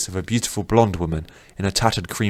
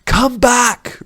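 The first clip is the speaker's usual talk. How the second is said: much higher, loud desperate yell; 6 dB louder than usual. Acted. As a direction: scream for him to return. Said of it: "Rin yelled".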